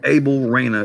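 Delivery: plain American-accented reading of the name. Shown as a demonstration. Host re-saying the DA's name, American accent. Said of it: "if you say it with an American accent".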